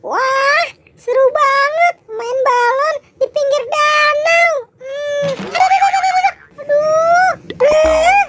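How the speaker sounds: a squeaky cartoon kid, thrilled then yelping; high character voice. Character playing with balloons. (suara tokoh kartun melengking)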